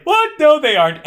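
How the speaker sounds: high, startled denial; squeaky, oblivious disbelief; clueless protest. Retold or imitated. Ellis retold as dim.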